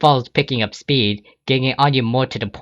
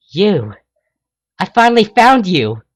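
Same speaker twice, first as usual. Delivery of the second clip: high loud shocked cry; surprised outburst; 9 semitones higher, 6 dB louder than usual. Acted. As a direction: finally caught him. Rainbow recognizes the thief.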